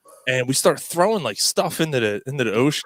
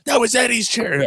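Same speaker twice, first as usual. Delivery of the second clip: imitating an upset friend; 9 semitones higher than usual. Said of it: "He got so upset with me".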